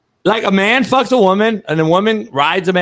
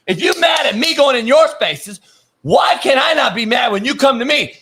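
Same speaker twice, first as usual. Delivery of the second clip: higher, shouted complaint; heated shout; 6 semitones higher than usual. Real, not acted. Heated rant about leagues.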